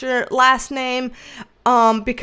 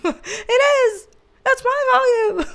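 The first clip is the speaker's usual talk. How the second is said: high squeal through laughter; real laughing fit, pitched way up; helpless laughing; 9 semitones higher than usual. Real, not acted. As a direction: squeak the words out while cracking up. Cracking up at own joke.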